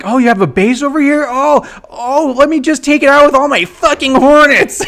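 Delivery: high, mocking sing-song sarcasm; sarcastic high-pitched gloating; mock sarcastic taunt. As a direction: feign surprise, then gloat. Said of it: "and I'm like".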